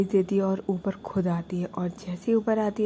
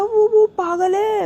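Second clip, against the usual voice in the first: slow, loud, crying break; 13 semitones higher, 11 dB louder, slower than usual. Retold or imitated. Prachi cries to her sister about her daughter, loud and slow. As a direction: break down in tears mid-sentence.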